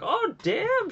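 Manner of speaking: sudden very high-pitched "oh, damn"; mock surprise. Fan reacting to a near tap-out, high surprised squeal. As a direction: yelp it up high like you can't believe it.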